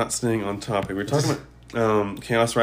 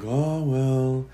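put-on very old faint voice; 3 dB quieter than usual. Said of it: "how old people just talk very vague".

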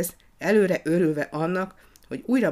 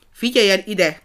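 louder, higher furious call; angry shout; 4 semitones higher, 8 dB louder than usual. A narrator's reading. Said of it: "kiáltotta dühösen Frici".

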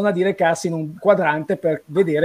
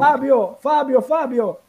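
calling out; 6 semitones higher, slower than usual. Retold or imitated. Calling out to a friend.

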